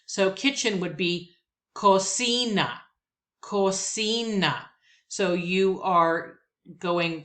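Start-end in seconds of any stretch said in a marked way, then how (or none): 1.7-4.7 cocina said slowly, co-CI-na, stress on CI; cocina, lean on the middle syllable. Shown as a demonstration. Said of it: "notice my body did techo".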